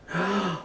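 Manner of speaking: sharp gasp; horrified gasp. Acted. Jonah hears he will be thrown in, shocked gasp.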